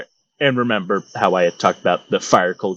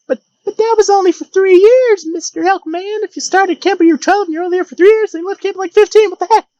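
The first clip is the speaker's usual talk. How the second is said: much higher, louder whiny objecting voice; mock listener voice; 4 dB louder than usual. Imagined listener protesting to the storyteller, high and whiny. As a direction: squeak out the complaint like a baffled fan. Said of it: "But I hear you say".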